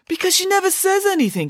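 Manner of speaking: put-on very high-pitched, eager boy's voice; guileless, besotted brother; lovestruck boy. A narrator's reading. Lovestruck Binky defends his reasoning.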